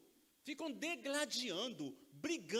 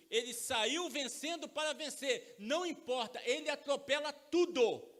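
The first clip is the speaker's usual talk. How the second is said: pregue com força, volume alto e palavras marcadas; voz de pregador, alta e enfática; pregação inflamada; 3 semitones higher, 5 dB louder than usual. Acted. (pregação vigorosa no púlpito)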